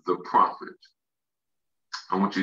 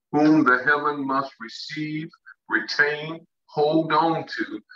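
slow, higher, stretched-out proclamation; very slow reading; 6 semitones higher, slower than usual. Shown as a demonstration. Preacher explaining what heaven must do, very slow emphatic reading. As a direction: hold out each key word of the verse. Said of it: "I'm gonna read that very slow".

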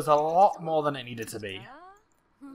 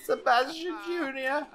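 excited celebration; 9 semitones higher than usual. Real, not acted. Gamer cheering that Sebastian Junior is here, excited. Celebrating the newly named baby.

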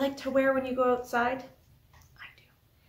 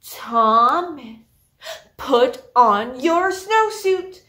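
louder and slow, each word pressed; louder order; 8 dB louder, much slower than usual. Acted. Teacher repeating to Thomas, louder and slower. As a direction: bark it out louder, slowly. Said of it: "said even louder".

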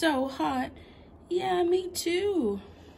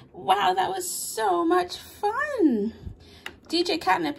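ordinary talk

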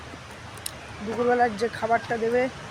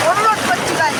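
loud; 8 semitones higher, 8 dB louder than usual. Real, not acted. Loud outro before cold bath.

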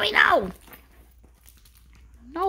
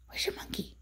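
whisper; 14 dB quieter than usual. Real, not acted. Same question whispered.

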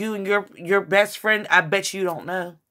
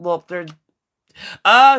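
ordinary talk

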